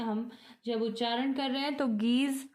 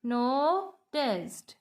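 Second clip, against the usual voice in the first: NOTICED broken in two parts, no-ticed, with a pause between; split into syllables. Shown as a demonstration. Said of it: "दो टुकड़ों में".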